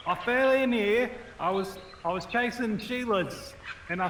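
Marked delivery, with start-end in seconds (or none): 0.1-1.1 calling out. Pretend. Shouting up from the well.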